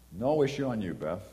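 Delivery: ordinary delivery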